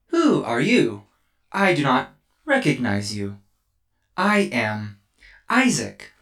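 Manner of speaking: chirpy little robot introducing itself; robot child. Acted.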